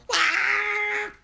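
very high, loud acted scream; loud shriek. Heron screams as she lays, shrieking. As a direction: shriek it out at full pitch. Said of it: "la garza gritó".

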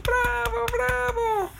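very high squeaky cheer; cheering little friends. Acted. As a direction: cheer like tiny creatures applauding. Amigos aplauden y vitorean. (vítores con vocecita muy aguda)

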